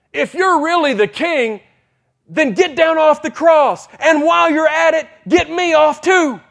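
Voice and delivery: high, loud scoffing shout; loud jeer from a scoffing thief. Retold or imitated. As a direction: shout it in open scorn. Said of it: "He's just scoffing".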